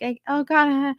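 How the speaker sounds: mock panic, pitched well up; high, hammed-up fluster; freaking out. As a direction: imitate someone flustered on the spot. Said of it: "they freak out".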